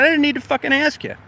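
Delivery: high, indignant snap; indignant retort. Retold or imitated. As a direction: fire back in retold outrage. Quarrel with the inspector.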